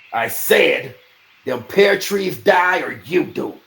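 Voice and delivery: slow, loud, menacing repeat; cold repeated threat. A narrator's reading. Bennis repeating his threat after cutting the man, slow and loud. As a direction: hammer the threat home.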